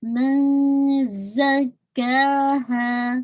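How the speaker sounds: very slow من زکاہا with a buzzing ز and a hidden nasal ن; tajweed demo. Shown as a demonstration. Teacher models من زکاہا for her students, slow and buzzing. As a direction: recite من زکاہا slowly, holding the ز buzz. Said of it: "میں زا ہی نکال رہی ہوں".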